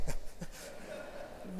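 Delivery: a quick breathy laugh at himself; amused chuckle; short laugh. Real, not acted. Laughs at surprise compliment.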